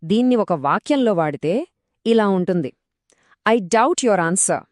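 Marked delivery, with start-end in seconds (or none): 3.5-4.6 doubt with a silent b, very slow; slow model sentence. Shown as a demonstration. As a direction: read it out slowly for learners. (నెమ్మదిగా చదివిన ఉదాహరణ వాక్యం)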